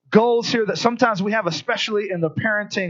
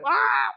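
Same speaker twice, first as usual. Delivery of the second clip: high imitated baby wail; baby scream; 13 semitones higher than usual. Father imitates his baby screaming at night, a high mock wail. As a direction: mimic a screaming infant. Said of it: "she was screaming".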